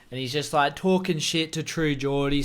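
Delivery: ordinary talk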